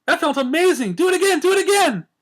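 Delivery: put-on very high, loud childlike pleading; giddy begging. A narrator's reading. Pinkie begging Twilight to repeat the spell, squealing high.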